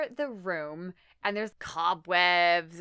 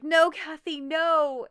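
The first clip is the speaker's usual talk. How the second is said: high, loud mock cry of alarm; desperate plea; 8 semitones higher, 7 dB louder, slower than usual. Husband pleads as wife raises gun, high loud cry. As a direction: imitate a desperate shout to stop her. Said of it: "he's like".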